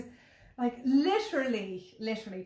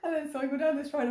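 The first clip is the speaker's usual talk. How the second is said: normal talking voice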